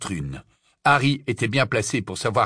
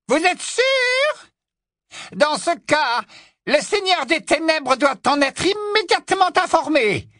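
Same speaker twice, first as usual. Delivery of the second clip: very high, shrill witch voice; a fanatical witch, urgent and commanding; imperious witch; 3 dB louder than usual. Acted. Witch demands her master be informed at once, imperious.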